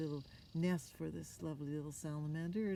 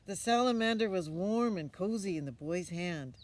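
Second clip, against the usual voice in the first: bright, louder read-aloud voice; storybook reading; 3 semitones higher, 9 dB louder than usual. A narrator's reading. Reading a picture book to a child, lively narration.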